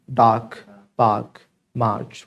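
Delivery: डार्क, पार्क, मार्च with long "aa" and no r; silent r. Shown as a demonstration. Teacher modelling words with r after a vowel, silent r. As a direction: say "daak, paak, maach", low and falling. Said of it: "last में जो आर आ जाए".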